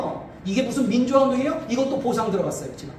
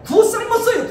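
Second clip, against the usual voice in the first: high, loud burst of excitement; high-pitched gleeful exclamation; excited exclaim; 11 semitones higher, 6 dB louder than usual. Real, not acted. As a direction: throw it up high and loud with relish. Story of poet winning chairman over.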